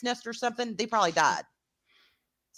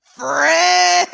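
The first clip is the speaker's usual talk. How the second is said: loud, high laughing monster yell; Ludo shout; 8 semitones higher, 10 dB louder than usual. Retold or imitated. Mocking Ludo's greeting, loud laughing yell. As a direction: belt the word through a laugh. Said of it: "Like Odo".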